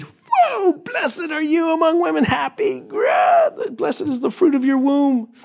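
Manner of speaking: crying out high in joyful awe; loud cry. Acted. Elizabeth crying out to Mary in high joyful awe. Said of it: "cried out with a loud voice".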